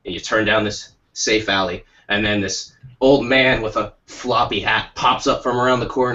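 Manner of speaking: ordinary talk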